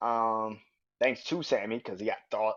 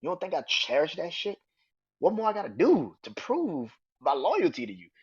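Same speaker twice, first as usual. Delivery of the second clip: pleading; 8 semitones higher, 3 dB louder than usual. Retold or imitated. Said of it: "He said".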